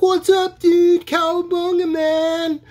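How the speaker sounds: a goofy, squeaky party-dude impression; surfer dude. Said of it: "Michelangelo was always like".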